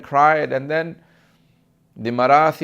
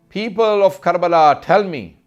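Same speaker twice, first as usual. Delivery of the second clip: raised, louder mourning call; retold loud lament; 5 semitones higher, 6 dB louder than usual. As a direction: call out to the people in a mother's lament. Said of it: "cries so loudly".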